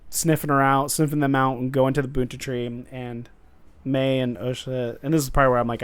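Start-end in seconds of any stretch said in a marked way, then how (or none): none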